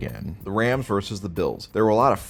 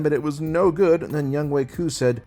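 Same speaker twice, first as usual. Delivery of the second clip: usual speaking voice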